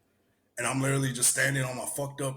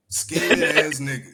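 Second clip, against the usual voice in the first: a louder, slower teasing insult; loud slow jab; 7 dB louder, slower than usual. Real, not acted. Teasing his friend, a louder, slower insult. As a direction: lean on each word of the jab. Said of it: "calls friend a schemer".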